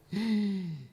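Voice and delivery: amazed little gasp; sharp gasp of amazement; gasp of wonder. Retold or imitated. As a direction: catch the breath in awe. Counting the baby's fingers.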